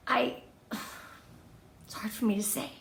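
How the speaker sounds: sighing, then whispered confession; pained whisper. Real, not acted. Host admitting her self-doubt to viewers, whispered. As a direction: whisper it like it costs you to admit.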